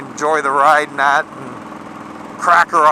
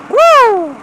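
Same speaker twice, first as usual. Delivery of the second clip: excited whoop; 7 dB louder than usual. Real, not acted. Thrill of the new bike.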